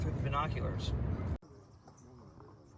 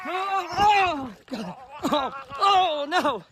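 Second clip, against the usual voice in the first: surprised outburst; 9 semitones higher, 6 dB louder, much slower than usual. Real, not acted. Sudden exclamation on seeing bird.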